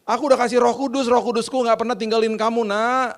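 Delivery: high, loud, exasperated God; loud, high-pitched reproach; God answering. Acted. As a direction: act out God scolding a child. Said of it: "Tuhan gini".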